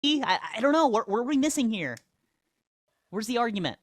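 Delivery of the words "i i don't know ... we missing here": ordinary talk, nothing marked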